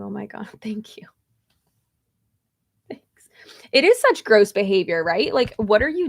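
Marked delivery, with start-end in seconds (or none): 0.0-3.3 a soft, breathy whisper of thanks. Real, not acted. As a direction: whisper it, a little embarrassed. Reacting shyly to a compliment.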